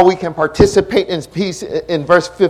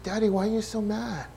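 put-on small, higher, hushed child's question; imitated scared son; 5 semitones higher, 10 dB quieter than usual. Scared son asking his mad daddy, timid imitation. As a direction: mimic a frightened kid, soft and pitched up. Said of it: "he got scared".

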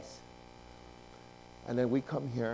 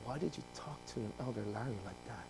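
very soft, low question; an inner voice gently calling him to account; God's quiet reproach; 7 semitones lower, 15 dB quieter than usual. Retold or imitated. God poking his heart.

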